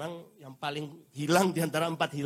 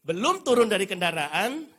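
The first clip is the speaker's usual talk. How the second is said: ordinary delivery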